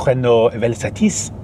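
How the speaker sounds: slow, mock-French pronunciation with throaty R; French accent. Shown as a demonstration. Car vlogger demonstrating how the French might say the name, French accent. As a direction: put on a French accent, drawn out. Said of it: "I'll do the accent".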